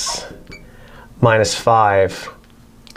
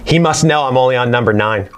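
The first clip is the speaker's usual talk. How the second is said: usual speaking voice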